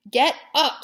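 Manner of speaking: high, loud scream. A narrator's reading. Pig screams at lounging rat. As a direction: yell at the lazy rat. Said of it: "screamed Wilbur".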